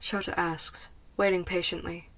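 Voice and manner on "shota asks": plain reading voice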